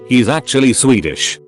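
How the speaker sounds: plain reading voice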